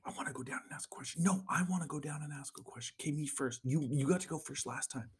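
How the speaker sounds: fast hushed whispers between siblings upstairs; kids whispering. Retold or imitated. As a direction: whisper so Dad won't hear.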